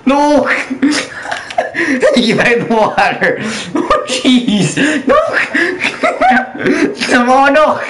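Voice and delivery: laughing, high pitched no; cracking up while protesting. Real, not acted. Teammate knocks him, he laughs.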